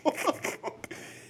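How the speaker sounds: breathless high-pitched laugh; high laughter. Real, not acted. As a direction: lose it laughing. Cracking up after story.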